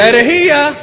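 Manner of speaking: high, loud echoed accusation; mock outcry. Interpreter echoing the preacher's heresy cry, mock outcry. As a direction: cry out in pretend scandal.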